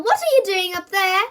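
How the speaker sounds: loud, high call. A narrator's reading. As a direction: call up the stairs loudly. Mum calling upstairs.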